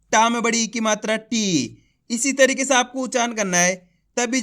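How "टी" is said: टी with a long, falling ee; drawn-out vowel. Shown as a demonstration. Teacher shows learners how to read the long ee, drawn-out टी. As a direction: stretch टी and let it drop. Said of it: "खींच के पढ़ा जाता है".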